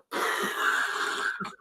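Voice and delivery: unvoiced burst of held-in laughter; spluttering laugh. Workmates creasing up at the accent, spluttering laugh. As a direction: splutter as if failing to hold laughter. Retelling their fit of laughter.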